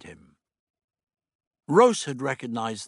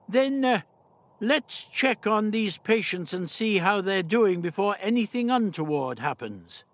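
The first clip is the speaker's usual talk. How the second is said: put-on old doctor voice; 8 semitones higher than usual.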